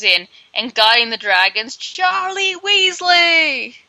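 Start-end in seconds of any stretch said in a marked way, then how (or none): 1.8-3.8 high, drawn-out announcer call; announcing. Acted. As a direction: announce the name like a star entrance, stretched out. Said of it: "he has to announce Charlie Weasley".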